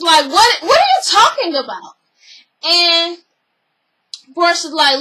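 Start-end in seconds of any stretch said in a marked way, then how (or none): 0.3-2.0 higher, louder indignant question; indignant retort. Retold or imitated. Cynthia snapping at Portia, higher and louder. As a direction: fire back in disbelief. Heated exchange retold.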